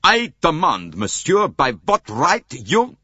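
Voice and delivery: loud, high, slowed indignant demand; furious outburst. A narrator's reading. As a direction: explode with outrage, cut off. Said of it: "burst out furiously".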